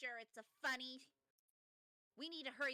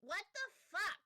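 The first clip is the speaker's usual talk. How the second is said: baffled outburst; 7 semitones higher, 4 dB louder, slower than usual. Real, not acted. Confused by the pun.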